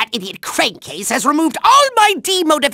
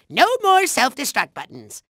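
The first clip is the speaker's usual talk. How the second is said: ordinary talk, nothing marked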